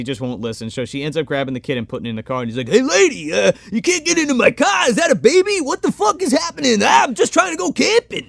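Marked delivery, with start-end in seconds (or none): 2.7-8.3 much higher, loud, whining loudmouth; Max's obnoxious voice. Retold or imitated. Driver objecting to a woman getting in his car, obnoxious high voice. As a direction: imitate an exasperated crass comic, pitched way up. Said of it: "This is not an exaggeration of his voice".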